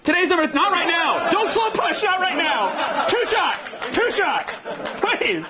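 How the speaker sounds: high-pitched directing. Real, not acted. Directing the camera crew.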